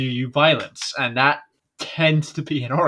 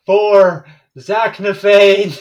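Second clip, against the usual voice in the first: loud, drawn-out chant, pitched up; rallying cry; 6 semitones higher, 6 dB louder, slower than usual. Acted. One fan rallying for Zaknafein, rallying cry. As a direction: shout it slowly like a battle cry. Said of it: "one guy that's going around like".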